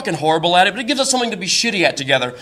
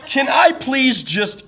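high pleading; 8 semitones higher, much slower than usual. Retold or imitated. Pleading to avoid arm wrestling.

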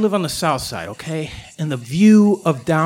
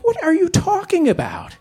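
a high, baffled question; incredulous; 11 semitones higher than usual. Retold or imitated. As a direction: shoot it up high in disbelief. Baffled reply to talking coyote.

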